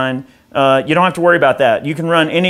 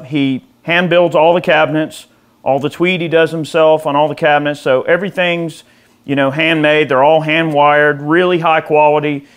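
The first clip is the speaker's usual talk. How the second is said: usual speaking voice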